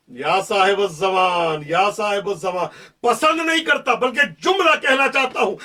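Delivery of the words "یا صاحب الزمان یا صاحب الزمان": devotional call. Real, not acted. Preacher calls out to the Imam.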